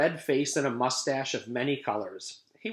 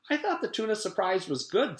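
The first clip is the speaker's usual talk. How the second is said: mild protest; 10 semitones higher than usual. Acted. Lewis voiced higher.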